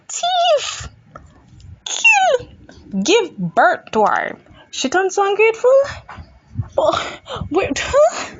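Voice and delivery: high-pitched, squawking outburst; mock outraged rant. Ranting at an ungrateful child, mock outrage. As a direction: cry out each accusation in hammed-up outrage.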